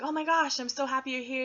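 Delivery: excited greeting. Retold or imitated. Uncle greets her at church.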